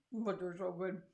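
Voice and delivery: talking through a mouthful of candy; chewing while speaking; mouth full. Real, not acted. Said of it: "Trying not to talk with my mouth full".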